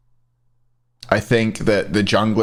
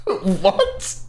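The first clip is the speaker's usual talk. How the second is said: laughing what; 10 semitones higher than usual. Real, not acted. Bemused at chat's odd comments.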